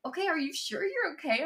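soft, high imitation of a worried boyfriend; imitated cautious, hushed question; tentative concern. Imitating boyfriend gingerly checking on her.

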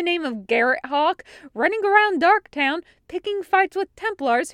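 scolding. A narrator's reading. Captain scolding Hawk.

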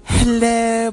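high sung-out British hello; mock British accent on Hello. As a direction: greet like a posh Brit. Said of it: "practice my British accent".

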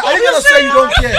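excited. Real, not acted. Heated debate, pressing the question.

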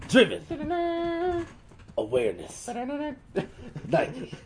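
dramatic trailer announcer with long pauses; suspense commercial voice. Acted. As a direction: build suspense like a sportswear ad voiceover. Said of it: "like, building suspense commercials and shit".

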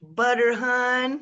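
attempted Southern accent; lightly put-on Southern accent. Shown as a demonstration. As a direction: have a go at a Southern drawl. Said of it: "you could do the Southern accent".

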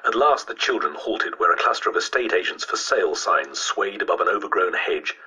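usual speaking voice

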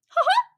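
squeaky cartoon mouse giggle; Mickey Mouse laugh. Vlogger doing the cartoon mouse's laugh, very high. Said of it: "that's my Mickey Mouse impression".

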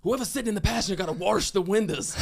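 mock Southern coach giving road-trip rules; imitating Southern coach. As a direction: bark it like the old juco coach. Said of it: "this is how he said it too".

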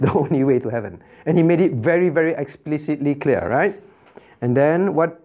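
ordinary talk

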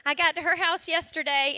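ordinary talk, nothing marked

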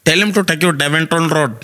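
imitated Indian accent. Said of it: "anatupa direction".

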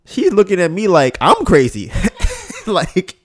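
ordinary talk

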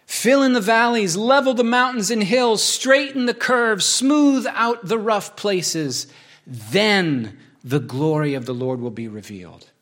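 high, strong preached proclamation; preaching at full force. Acted. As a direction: preach it out, stretching each line. Rereads the passage with force.

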